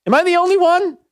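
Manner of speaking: high, exasperated cry to the listeners; frustrated yelling. Real, not acted. Radio host asks listeners if anyone else agrees, frustrated yelling. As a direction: throw it out high, in disbelief. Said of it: "I'm yelling because".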